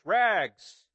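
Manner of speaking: loud, ringing cry of a street vendor; a ragman crying his wares; street call. Acted. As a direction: call it out clear down the alley. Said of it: "calling in a clear tenor voice".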